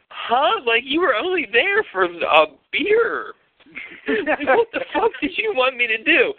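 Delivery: high-pitched, baffled retold protest; squeaky-high mock bewilderment; incredulous protest. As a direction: protest in disbelief, voice pushed way up. Retold protest at her accusation.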